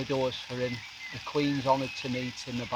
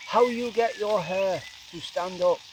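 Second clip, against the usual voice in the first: slow imitated holy man, pitched up; put-on measured question, word by word; Dalai Lama; 4 semitones higher than usual. Imitating a holy leader asking about a ponytail, slow higher impression. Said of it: "Pretty good impression".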